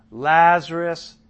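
drawn-out loud call of the name. A narrator's reading. Jesus calling Lazarus out of the tomb, slow and loud. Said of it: "called out in a loud voice".

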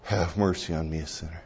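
soft prayer fading toward silence; slow breath prayer. Shown as a demonstration. Pastor showing how he prays himself to sleep, fading murmur. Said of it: "I breathe in, I match my breathing to it".

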